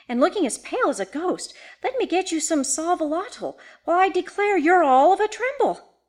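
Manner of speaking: high-pitched fussing housekeeper; exclaimed. A narrator's reading. Housekeeper seeing Miss Sarah pale as a ghost, exclaimed. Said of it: "she exclaimed".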